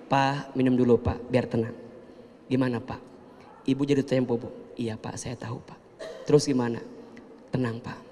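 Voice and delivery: wife calmly hosting the debt collector; calm and soft. Retold or imitated. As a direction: soothe him politely.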